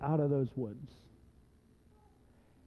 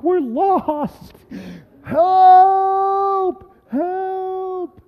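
high, loud wail into stretched cries; panicked yelling; 13 semitones higher, 9 dB louder, much slower than usual. Retold or imitated. Lost hiker tells Susie and calls for help, panicked yell. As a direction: cry out for rescue, very high. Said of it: "yelling out".

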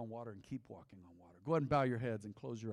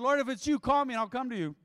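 Peter calling out; 10 semitones higher, 10 dB louder than usual. Acted. Peter calls to Jesus.

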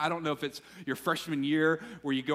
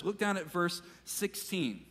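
ordinary talk, nothing marked

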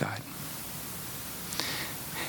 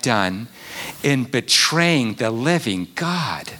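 preaching climax; 4 semitones higher, 4 dB louder than usual. Real, not acted. Preacher urging the congregation toward humility, loud and high. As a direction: build to a full-force appeal.

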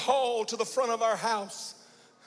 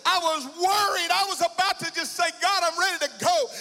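climbing, more urgent with each line; rising preacher cry; 6 semitones higher than usual. Acted. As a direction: press the anguish upward as you preach. Building preaching intensity.

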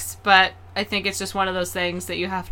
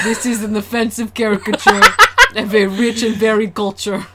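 hammed-up Russian, amused; mock Russian accent; 6 dB louder than usual. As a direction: fake Russian, breaking into laughter. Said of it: "the only accent I can do".

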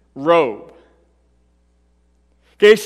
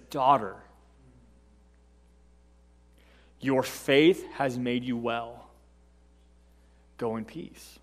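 very soft and slow, gentle blessing; tender; 8 semitones lower, 12 dB quieter, slower than usual. Acted. Jesus blessing the healed woman, soft and tender. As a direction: speak to her like a loving father.

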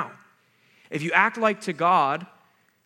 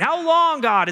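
high, loud desperate yell; yelling at God; 12 semitones higher, 7 dB louder, slower than usual. Shown as a demonstration. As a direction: throw the question up at heaven. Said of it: "teaches us to yell at God".

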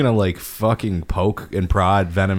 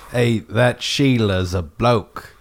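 put-on Australian accent; 3 semitones higher, much slower than usual. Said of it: "that accent".

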